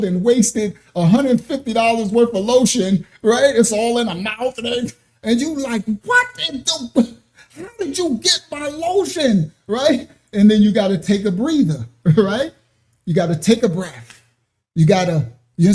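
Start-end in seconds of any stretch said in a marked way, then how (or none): 6.0-9.5 a stunned parent catching the mess; shocked parent. Retold or imitated. Parent walking in on the baby covered in lotion, mock shocked.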